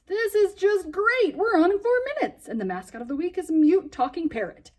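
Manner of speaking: put-on a boy despairing that the mascot won't talk; exasperated panic. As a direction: throw up your hands in panic. Boy panicking before airtime.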